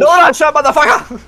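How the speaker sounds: loud high yell; shouting. Real, not acted. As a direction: bellow it at the team. Yelling in game chat.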